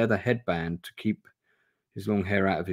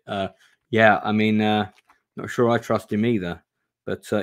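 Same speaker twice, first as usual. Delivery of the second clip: normal talking voice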